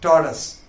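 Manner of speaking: "tortoise" as TOR-tus, falling at the end; "tortoise" without any OY in it; correct pronunciation. Shown as a demonstration. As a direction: say "tortoise" with a weak "-tus" ending. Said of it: "That is the right pronunciation".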